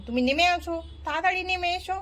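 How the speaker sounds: normal talking voice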